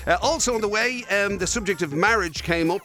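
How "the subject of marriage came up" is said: usual speaking voice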